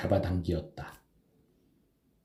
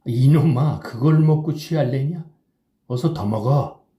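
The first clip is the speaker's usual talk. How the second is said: put-on drunken yelling; 5 semitones higher, 5 dB louder than usual. A narrator's reading. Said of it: "취한이는 부르짖었다".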